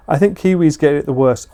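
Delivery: usual speaking voice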